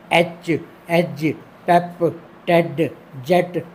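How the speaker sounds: slow, each word set apart; एच एज पेप टेड जेट with a quick short ए; short e vowel. Shown as a demonstration. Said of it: "sound जल्दी ख़त्म हो रहा है".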